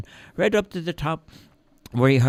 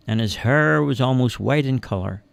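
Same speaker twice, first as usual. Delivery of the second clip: ordinary delivery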